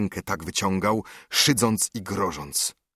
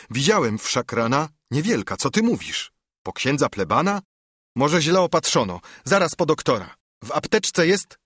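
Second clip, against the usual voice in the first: higher and louder, alarmed brother rushing on; frantic, climbing higher as he urges a doctor; alarmed exclamation; 6 semitones higher, 3 dB louder than usual. Acted. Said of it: "krzyknął sędzia".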